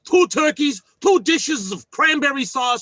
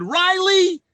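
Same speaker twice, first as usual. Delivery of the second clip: a much higher, gushing imitation of the family's delight; retelling how the family cheered the idea in a high gushing voice; mock delighted family; 9 semitones higher than usual. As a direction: play the family's squeal of joy high and bright. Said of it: "Well, they said".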